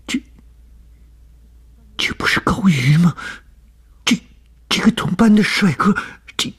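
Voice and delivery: very high, agitated inner outburst; burning anger. A narrator's reading. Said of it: "顿觉怒火中烧". (怒火中烧，声音尖高激动)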